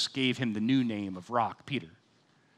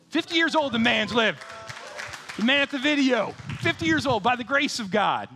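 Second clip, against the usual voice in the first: much higher and louder, cheering a friend; excited celebration; 8 semitones higher, 3 dB louder than usual. Real, not acted. Pastor cheering his friend Anthony's fiftieth, excitedly. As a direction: whoop it up for the birthday man.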